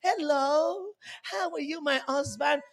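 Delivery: honeyed wife calling her husband; mayonnaise voice. Retold or imitated. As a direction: coo it at him like honey. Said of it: "your mayonnaise voice".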